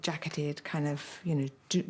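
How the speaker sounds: usual speaking voice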